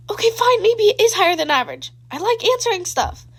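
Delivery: okay fine, said with playful glee; cheerful concession. A narrator's reading. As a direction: give in with a grin.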